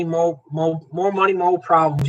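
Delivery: ordinary talk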